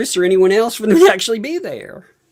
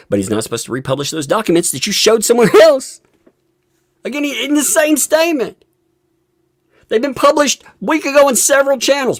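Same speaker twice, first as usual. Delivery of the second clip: loud, frustrated outburst, pitched high; worked up; 7 semitones higher, 4 dB louder than usual. Real, not acted. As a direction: rant in real exasperation at their stupidity. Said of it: "I got a little worked up there".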